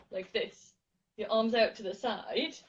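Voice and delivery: ordinary delivery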